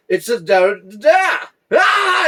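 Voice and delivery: high, loud shouted punchline; toddler punchline shout. Retold or imitated. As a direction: yell the nonsense punchline like a gleeful toddler. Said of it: "And he'd go".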